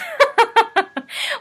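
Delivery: bright loud burst of laughter; loud high laugh. Real, not acted. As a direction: laugh it off, amused. Laughs after mock coffee invite.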